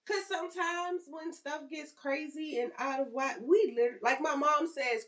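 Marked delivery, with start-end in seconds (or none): none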